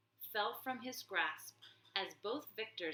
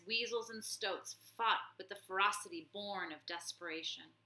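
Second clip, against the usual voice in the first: plain reading voice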